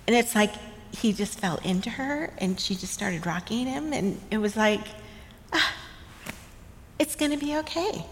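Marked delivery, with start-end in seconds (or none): 5.5-8.1 soothing grandmother. Retold or imitated. Grandmother soothing crying newborn.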